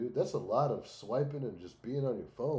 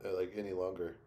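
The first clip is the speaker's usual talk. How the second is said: ordinary talk, nothing marked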